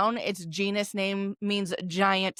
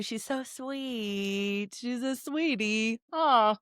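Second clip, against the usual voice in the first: fond, softened; 3 semitones higher, 4 dB quieter, much slower than usual. Real, not acted. Fond words about her dog.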